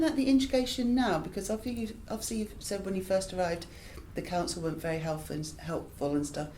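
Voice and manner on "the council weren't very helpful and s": ordinary delivery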